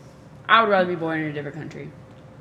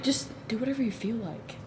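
imitating Mark; 3 semitones higher, 8 dB quieter than usual. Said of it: "Mark was like".

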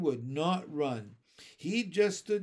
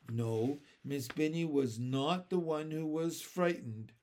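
plain reading voice

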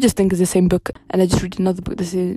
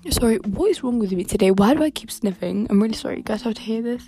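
normal talking voice

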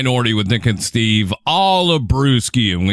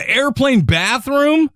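shocked; 12 semitones higher than usual. Real, not acted. Shocked at barefoot airplane bathroom.

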